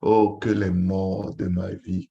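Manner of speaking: ordinary delivery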